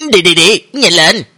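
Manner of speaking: acted eager prodding; urging. A narrator's reading. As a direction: hustle your friends along impatiently. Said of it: "lúc này thúc giục hai người bọn họ".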